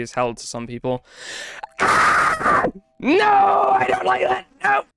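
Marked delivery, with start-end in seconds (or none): 1.8-2.7 sudden high breathy scream; scream of fright. Real, not acted. Jump scare in horror game. 3.0-4.8 high, frightened outcry; scared yelp. Real, not acted. Jump scare in horror game.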